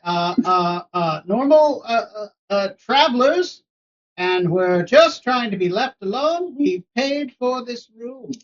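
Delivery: high, halting, nervous traveler; high, slow, flustered stranger voice. Acted.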